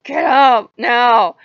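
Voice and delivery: very loud, high, drawn-out mock bellow; imitated shouted order. Impatient god ordering her out of the chair, mock furious shout. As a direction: bellow each word slowly as a command. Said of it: "And he's like".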